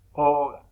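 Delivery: Oga with the first vowel O hit loud; stress before final vowel. Shown as a demonstration. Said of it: "por lo tanto lleva acento pintado". (Oga con la o inicial acentuada y fuerte)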